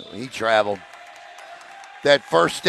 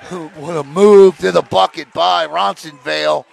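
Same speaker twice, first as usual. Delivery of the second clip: loud burst of admiration; loud, impressed play-by-play; excited call; 2 semitones higher, 11 dB louder than usual. Real, not acted. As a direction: call the basket with sudden excitement. Live call of a basket.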